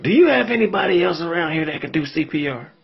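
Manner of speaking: husband asking clerk. Retold or imitated. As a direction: play the old husband, high and pressing.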